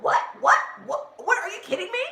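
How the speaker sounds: stunned, rising high disbelief; shocked, can't believe the bill; retold shock. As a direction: repeat what in shock, then shoot up high. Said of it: "And I was like".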